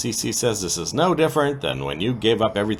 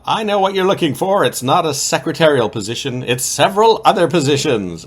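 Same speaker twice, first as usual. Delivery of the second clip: high, louder sultry come-on; playful mock seduction pitched up; seductive Courtney; 7 semitones higher, 8 dB louder than usual. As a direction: purr it out as a flirt making her move. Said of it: "Courtney has in fact fallen for him and decides".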